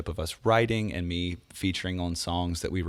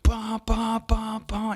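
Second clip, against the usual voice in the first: high, punchy "bah" guitar hits; imitated electric guitar riff; slower than usual. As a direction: voice the guitar chords as high staccato bahs. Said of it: "he starts going".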